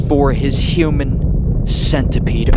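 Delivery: loud, slow, booming hype; hyped announcer. Real, not acted. Vlogger hyping his audience for the big scene, loud and slow. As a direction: build it up like a ring announcer.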